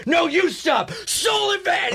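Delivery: high angry mock shout; veteran shouting back. Veteran at the mall shouting back at his wife, high mock yell. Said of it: "And he goes".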